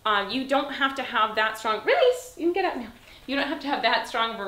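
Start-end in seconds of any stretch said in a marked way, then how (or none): 1.9-2.9 bright high-pitched call to the dog; high release cue. Real, not acted. Trainer calling her dog up from the down.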